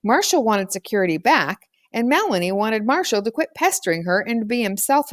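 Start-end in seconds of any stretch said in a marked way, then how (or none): none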